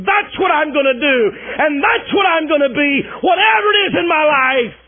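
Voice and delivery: loud, high pleading prayer; Daniel crying out to God in Babylon, loud and pleading; impassioned prayer. Acted. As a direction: cry out to God with everything. Daniel praying desperately.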